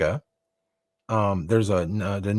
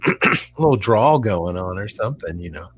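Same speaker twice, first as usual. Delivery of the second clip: ordinary delivery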